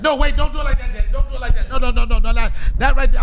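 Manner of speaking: put-on loud, high kid protest; a child frantically stopping daddy; bossy little son. Son stopping dad from helping with the project, loud high. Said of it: "he like".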